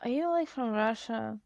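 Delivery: softly acted, hesitant question; wary question. Acted wrong way of asking.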